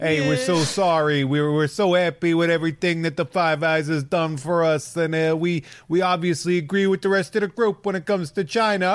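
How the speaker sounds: grovel in a put-on New Zealand accent. New Zealand apologising to the Five Eyes, mock New Zealand accent. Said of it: "in a New Zealand accent".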